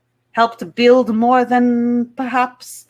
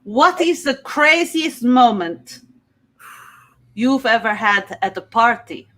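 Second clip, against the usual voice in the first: put-on heavy Russian accent. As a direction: play it as a Russian contestant. Miss Russia.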